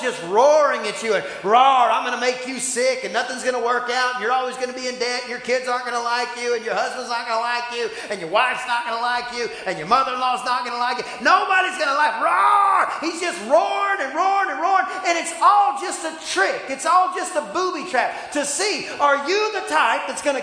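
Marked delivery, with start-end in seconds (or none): 1.4-12.9 a hint of a taunting devil, fast and louder; preacher lightly mimicking the devil's roaring threats; mock devil. 13.0-18.9 much higher, loud preacher's cry; preacher roaring at the congregation; shouted preaching. Real, not acted. Preaching peak.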